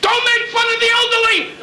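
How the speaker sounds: very high, laughing mock old mother shout; mother yelling. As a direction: shriek it like his old mother in Florida. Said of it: "my mother now ... going".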